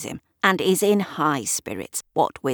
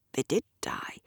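whispered admission; 8 dB quieter than usual. Acted. Gavin quietly agrees about flowers.

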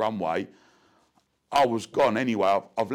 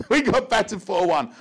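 bursting out laughing, much higher and louder; 12 semitones higher, 6 dB louder than usual. Real, not acted. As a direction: laugh through the score at your own disaster. Repeats the score as punchline.

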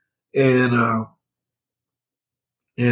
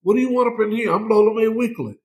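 much higher-pitched challenge; defiant mother; 9 semitones higher than usual. Retold or imitated. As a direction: imitate a mother challenging whoever is knocking. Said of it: "Vernon Steve Weekly said".

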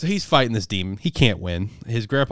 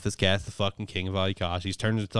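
ordinary talk

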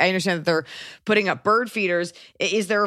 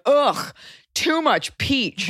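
higher, slow, sneering groan; mock disgust; 7 semitones higher, much slower than usual. Host mocking a peach hater, slow high whine. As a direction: whine it like a fed-up taster. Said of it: "and be like".

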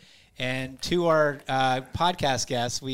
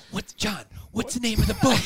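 imitated flustered appeal; 8 semitones higher than usual. Caught off guard on live radio.

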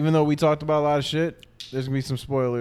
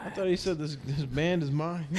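ordinary talk

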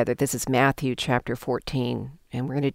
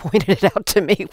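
laughing; 6 semitones higher, 5 dB louder than usual. Real, not acted. Laughing at own admission.

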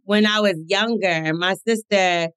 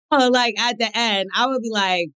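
ordinary delivery

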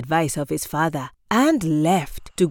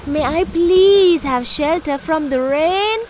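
put-on high, louder boy's polite plea; trickster tale voices; 10 semitones higher, 4 dB louder than usual. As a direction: plead like a drenched boy. Afi asks the tortoise.

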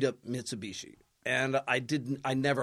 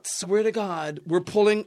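usual speaking voice

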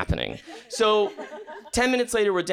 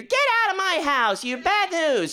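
hammed-up high-pitched scolding mom; angry fifties mom. Fifties mom grabbing a kid by the ear, mock-angry high scolding. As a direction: scold like a sitcom mother, voice way up high. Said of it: "one of those angry, like, fifties moms".

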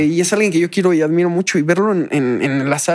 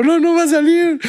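much higher, anguished; high despairing cry; 12 semitones higher than usual. Retold or imitated. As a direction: wail it in despair. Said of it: "y luego".

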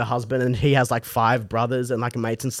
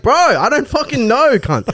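pitched way up, helpless and frantic; exasperated cry; 10 semitones higher, 3 dB louder than usual. Retold or imitated. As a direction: throw up your hands, no idea. Teller's panicked answer to driver.

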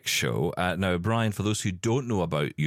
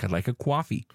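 put-on Sopranos accent; 4 semitones higher than usual. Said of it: "I start to say things like".

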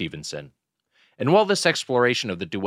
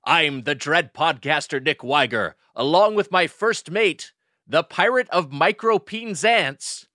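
put-on old sailor; 5 semitones higher, slower than usual. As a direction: ham it up like a salty sea captain. Pirate welcome to podcast.

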